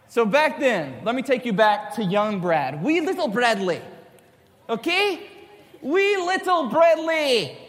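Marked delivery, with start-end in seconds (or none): 2.8-5.3 playful mock-Scottish accent; lightly put-on Scottish accent; bad Scottish accent. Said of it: "That's my really bad Scottish accent". 5.8-7.6 high, drawn-out mock-Scottish accent; slow, exaggerated Scottish lilt, pitched way up; bad Scottish accent. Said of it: "That's my really bad Scottish accent".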